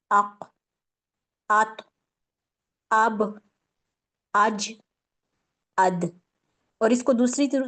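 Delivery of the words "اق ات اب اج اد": اق ات اب اج اد with the final letter bouncing back; each sakin letter pressed and released with an echo; qalqala demo. Shown as a demonstration. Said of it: "ایسے پڑھ لیں". (قلقلہ کے ساتھ ادائیگی)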